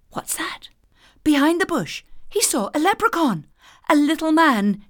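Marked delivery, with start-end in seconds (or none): 0.1-0.7 hushed curious whisper. A narrator's reading. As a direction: whisper it as if listening. Hearing a strange sound.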